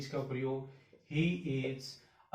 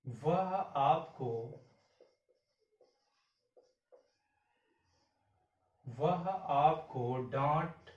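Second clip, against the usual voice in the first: slow dictation; 2 semitones lower, much slower than usual. Shown as a demonstration. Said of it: "इस चीज को गौर से देखिए".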